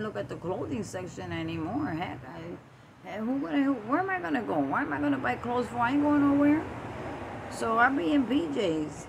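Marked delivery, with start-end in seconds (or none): 3.1-9.1 louder and higher, exasperated joking; mock rant. Real, not acted. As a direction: rant about staying home in pajamas. Ranting about never going out.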